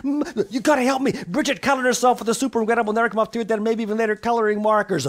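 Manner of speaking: high, loud, hurried plea; frantic mother. Acted. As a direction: beg the doctor for help. Mum pleads with the doctor.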